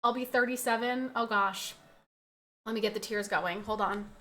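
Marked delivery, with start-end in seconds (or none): none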